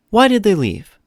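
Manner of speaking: usual speaking voice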